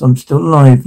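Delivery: usual speaking voice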